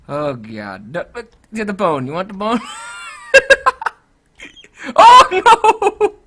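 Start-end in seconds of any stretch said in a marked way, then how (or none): 2.6-3.9 high giggling burst; real laugh. Real, not acted. Player loses it over the dog and bone, laughing. 4.9-6.1 loud, high cry of dismay; alarmed cry. Real, not acted. Player reacts to a sudden mishap, alarmed cry.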